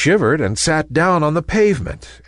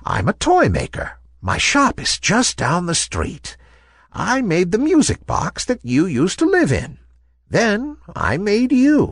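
put-on higher-pitched old woman voice; gentle old lady; 8 semitones higher than usual. A narrator's reading. Old lady revealing herself to the ballerina, gentle. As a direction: explain kindly as an old toy maker. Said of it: "the lady said".

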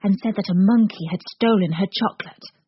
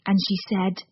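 plain reading voice

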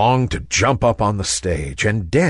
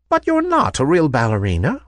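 put-on higher-pitched old woman voice; gentle old lady; 5 semitones higher than usual. A narrator's reading. Old lady revealing herself to the ballerina, gentle. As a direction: explain kindly as an old toy maker. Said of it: "said the old lady".

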